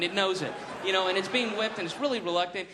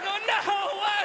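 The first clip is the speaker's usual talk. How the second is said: mock panicked crowd; 3 dB louder than usual. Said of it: "and they're like".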